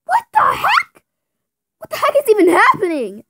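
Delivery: very high, confused shriek; shocked squeak. Acted. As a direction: shriek in total disbelief. Fidgets turned out fake.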